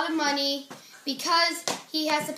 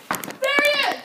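high, loud shout; excited shout; 9 semitones higher, 4 dB louder than usual. Pretend. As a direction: yell it out as you spot him. Spotting the culprit.

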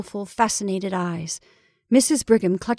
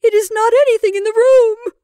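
high, loud, wailing denial; obstinate horror; 10 dB louder than usual. Acted. Rebecca denying any natural cause, obstinate horror. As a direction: insist in terror. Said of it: "repeated Rebecca with obstinate horror".

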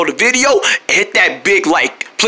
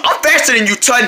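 ordinary talk

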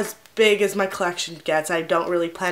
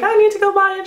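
eager high squeal; 12 semitones higher, 3 dB louder, faster than usual. Retold or imitated. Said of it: "I was like".

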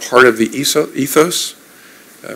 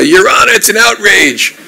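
high, loud mock outrage; imitated yell of protest to a judge; mobster's courtroom yell; 8 semitones higher, 5 dB louder than usual. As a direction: bellow it like an indignant mob boss, drawing out the end. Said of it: "take out the stogie and yell".

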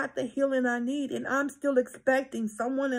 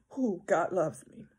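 ordinary delivery

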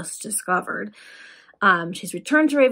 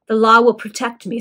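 loud, distressed protest; painful excitement; 2 semitones higher, 8 dB louder than usual. A narrator's reading. Adeline defying the Resurrection Man, in painful excitement. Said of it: "laboring under the most painful excitement".

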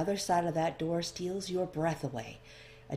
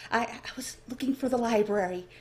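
nervous stammer; 6 semitones higher than usual. Acted. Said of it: "nervous tick".

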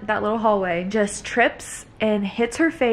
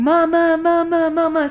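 mock toddler scream; 7 semitones higher, 5 dB louder than usual. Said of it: "Ella's screaming".

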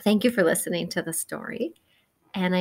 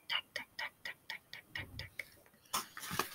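jackhammer taps fading into a whisper; 22 dB quieter than usual. Acted. Walking past the roadworks, jackhammer fading to a whisper. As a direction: let the tucks die away to breath.

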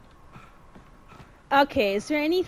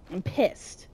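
soft, low muttered complaint; low grumble; 10 semitones lower, 9 dB quieter than usual. Real, not acted. Player fed up with a slow game hero, low grumble. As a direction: grumble it under your breath. Grumbles, then repeats it higher.

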